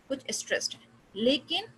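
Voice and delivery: usual speaking voice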